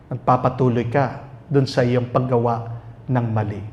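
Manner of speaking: usual speaking voice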